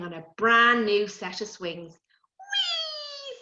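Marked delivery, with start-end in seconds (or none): 1.9-3.4 put-on much higher, gleeful child on a swing; excited whee. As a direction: squeal with joy as you fly up. Child on new slide.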